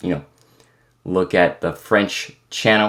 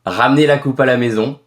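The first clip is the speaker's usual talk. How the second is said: quick burst of native French; fast French catchphrase in a native accent; 4 semitones higher, 4 dB louder, faster than usual. Shown as a demonstration. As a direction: rattle off a French line as proof. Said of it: "How can I prove that I'm French?".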